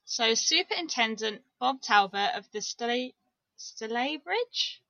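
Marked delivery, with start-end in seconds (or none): 3.6-4.8 drawn-out, questioning STALYBRIDGE, rising at the end; unsure pronunciation. Real, not acted. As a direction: sound out Stalybridge slowly as stuh-LAY-bridge, querying it. Unsure reading a place name.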